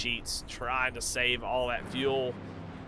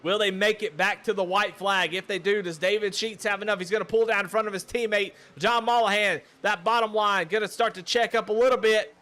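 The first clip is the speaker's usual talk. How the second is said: brisk, rising race call, a bit louder and higher; live play-by-play; 7 semitones higher, 5 dB louder than usual. Real, not acted. Commentator calling the restart to viewers, building energy. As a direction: pick up the energy as the field restarts.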